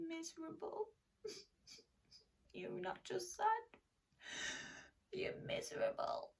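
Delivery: soft, whimpering mock misery; mock miserable. Teacher showing children a mock miserable voice. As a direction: ham up being miserable. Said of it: "Show me your miserable face".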